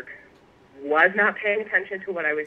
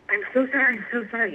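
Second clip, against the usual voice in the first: frantic apology; 7 semitones higher, faster than usual. Retold or imitated. Frantic apology after lathe accident.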